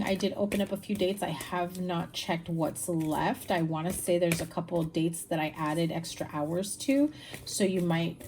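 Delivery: ordinary talk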